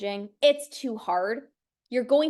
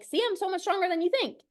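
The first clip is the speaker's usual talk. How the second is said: higher, quick, triumphant; proud excited; 6 semitones higher, faster than usual. Retold or imitated. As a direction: brag it brightly and fast. Voicing the proud body.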